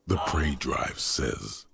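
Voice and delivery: usual speaking voice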